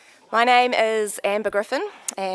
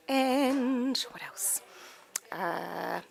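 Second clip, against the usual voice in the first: slow, whispered to herself; whispering while she thinks what to say; whispered aside; 3 semitones higher, 6 dB quieter, much slower than usual. Real, not acted. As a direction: mutter it under your breath as you think. Stalling for ideas, aside.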